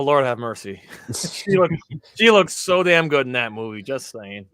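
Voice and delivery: ordinary talk, nothing marked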